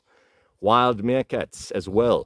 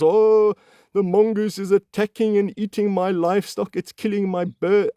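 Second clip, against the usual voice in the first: much higher, whiny complaining mock voice; 12 semitones higher than usual. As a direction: parody a fretting farmer. Mocking farmers' complaint.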